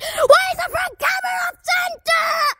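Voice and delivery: high-pitched shouted question; high squeaky shout. Acted.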